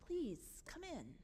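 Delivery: tender entreaty; gentle pleading. Acted. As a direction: beg softly, pausing after each please. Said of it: "pleads with him".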